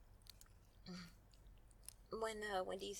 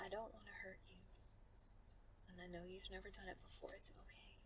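reassuring whisper; 2 semitones lower, 12 dB quieter, faster than usual. Acted. Reassuring partner.

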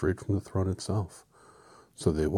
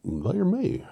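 high squeak; 7 dB louder than usual. Acted. High squeaky retake of a line.

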